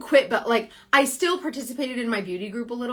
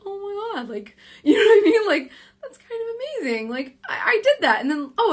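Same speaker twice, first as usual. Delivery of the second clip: much higher, giddy, laughing through it; thrilled squeal; 10 semitones higher, 4 dB louder than usual. Retold or imitated. Reliving her first success for viewers, giddy and laughing. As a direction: gush like you just did something huge. Acting thrilled self after inspiring.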